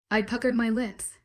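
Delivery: ordinary delivery, nothing marked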